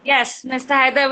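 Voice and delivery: ordinary delivery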